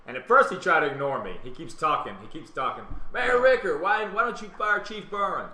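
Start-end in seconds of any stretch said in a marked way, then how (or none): 3.1-5.5 loud, high call across the crowd; retold heckling shout at the podium; shouted heckle. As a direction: yell it at the mayor over his speech. Heckling the mayor again.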